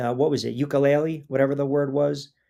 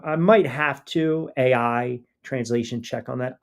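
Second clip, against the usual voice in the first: normal talking voice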